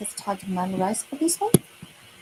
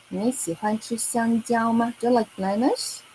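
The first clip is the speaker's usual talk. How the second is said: ordinary delivery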